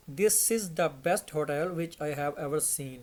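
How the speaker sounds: very slow and clear; careful teacher reading; slow model sentence. Shown as a demonstration. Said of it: "इसकी सही pronunciation".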